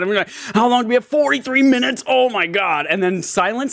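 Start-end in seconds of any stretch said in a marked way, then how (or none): 0.5-2.9 high, whiny imitation of a grumbler; mock complaining. Co-host mocks Joel griping in the trunk, high mock complaint. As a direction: imitate an endlessly griping passenger. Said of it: "he's nonstop complaining about how uncomfortable he is".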